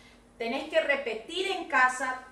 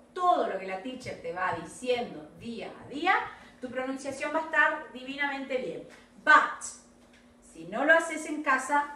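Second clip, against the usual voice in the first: ordinary delivery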